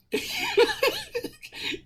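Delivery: high-pitched giggle; real laugh. Real, not acted. Storyteller amused after quoting the cop's threat, high laugh.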